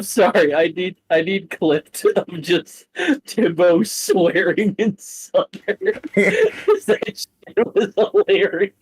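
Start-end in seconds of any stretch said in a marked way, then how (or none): 3.3-8.7 talking through giggles, voice squeaking high; cracking up at the player's swearing; laughing hard. Real, not acted. Game master breaking into laughter while recalling the scene.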